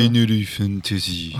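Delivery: put-on slow, drawn-out Japanese accent English. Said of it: "quelqu'un qui me fait Final Fantasy".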